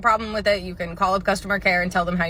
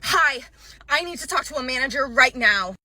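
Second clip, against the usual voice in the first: higher, entitled customer voice; sweetly pushy, wanting the manager now; demanding customer; 6 semitones higher than usual. Retold or imitated. Rude customer demanding a manager at the counter, mock-entitled.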